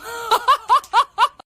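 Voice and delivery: loud, high cackle; real loud punchline laugh; burst of laughter. Real, not acted. Laughs at her own punchline.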